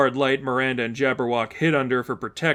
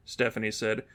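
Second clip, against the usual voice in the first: plain reading voice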